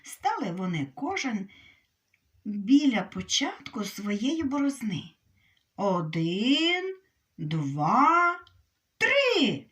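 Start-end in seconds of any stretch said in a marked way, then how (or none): 5.8-9.6 counting shout. Acted. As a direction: start the race with a shout on три. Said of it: "крикнув заєць". (рахунок, що наростає до гучного вигуку)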